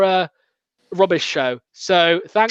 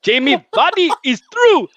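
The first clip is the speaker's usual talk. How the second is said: mock commentator; 8 semitones higher than usual. Mock commentary after accent joke.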